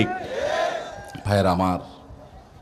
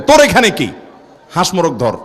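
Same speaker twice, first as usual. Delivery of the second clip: scolding grandparent. Acted. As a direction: shoo the boy off like a cross grandfather. Grandparents scold the boy who broke fast.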